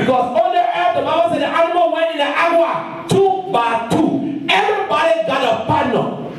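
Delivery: high, sustained chanting preacher cry; fervent preaching. Real, not acted. Preacher proclaims the Bible to the congregation, fervent. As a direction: preach it high and ringing, almost sung.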